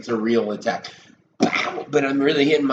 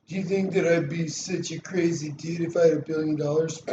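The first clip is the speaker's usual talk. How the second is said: higher put-on voice of a boastful guy; pretend character; 6 semitones higher than usual. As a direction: play someone else asking, a touch pompous. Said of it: "I was just pretending to be somebody right there".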